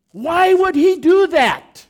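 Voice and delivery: high, loud and slow plea; anguished cry. Acted. Pastor asking the congregation why God did it, crying out slow and loud. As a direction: cry the question out to heaven. Preacher cries out question.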